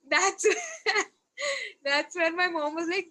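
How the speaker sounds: chuckling through the lead-in; laughing recollection. Real, not acted. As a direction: tell it while laughing at the memory. Laughing while recalling mom.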